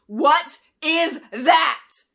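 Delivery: very slow, high, loud scream; drawn-out enraged scream. Acted. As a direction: scream each word in rage. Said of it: "she screamed".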